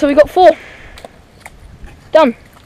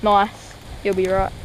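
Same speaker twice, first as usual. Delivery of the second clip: usual speaking voice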